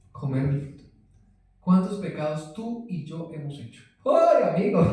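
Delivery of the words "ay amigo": laughing exclamation. Real, not acted. Laughs at his own sins. (exclamación entre risas)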